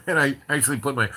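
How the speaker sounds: ordinary delivery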